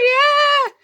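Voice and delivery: a quick high mock scream of yeah. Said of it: "[name] screaming in the background".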